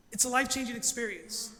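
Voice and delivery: ordinary delivery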